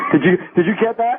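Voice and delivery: high-pitched, laughing question. Real, not acted. Host to guest after the outrageous clip, laughing. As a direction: barely get it out through laughter.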